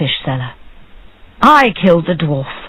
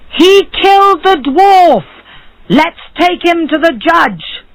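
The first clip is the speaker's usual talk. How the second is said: shouting crowd; 11 semitones higher than usual. A narrator's reading.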